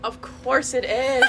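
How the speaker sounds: loud, slow, knowing scoff. Real, not acted. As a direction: say it like you saw it coming. Hearing the rival school's name.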